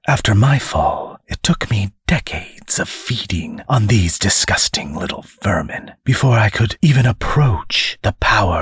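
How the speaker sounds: whispered demon menace; menacing demon. Acted. Demon explains his feeding.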